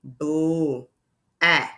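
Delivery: "Boo" as a drawn-out bl blend, then a separate short "Ah"; sounding out. Shown as a demonstration. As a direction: stretch the blend in "Boo", pause, give "Ah" as short a plus k. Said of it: "listen to the, uh, short vowel sound".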